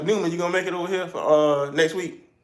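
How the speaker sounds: quick call. Real, not acted. Calling out to a friend.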